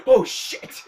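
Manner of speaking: sudden loud blurt of surprise; a loud imitated oh-no moment; startled curse. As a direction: blurt it out, caught off guard. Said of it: "He's like".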